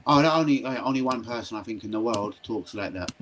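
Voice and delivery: ordinary talk, nothing marked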